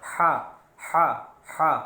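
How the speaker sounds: "हा" breathed from the throat, Urdu-style; throaty ha. Shown as a demonstration. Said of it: "बड़ा वाला है निकालेंगे". (गले से निकला "हा")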